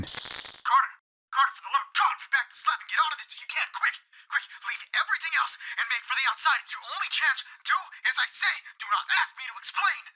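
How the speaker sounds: high, fast, hushed urgent pleading; wilder pitch. A narrator's reading. Warren urging Carter to run for his life, high and frantic. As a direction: urge him to flee, frantic. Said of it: "in a pitch of wilder concentration".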